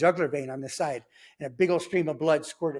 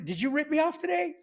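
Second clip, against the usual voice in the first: accusing question; 11 semitones higher than usual. Retold or imitated. Shooter confronting narrator.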